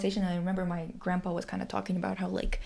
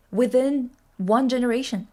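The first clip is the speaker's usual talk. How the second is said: louder, higher, punching each point; heated rant; 4 semitones higher, 6 dB louder than usual. Real, not acted. Ranting to friends about the USSR, loud and heated. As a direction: hammer the facts home.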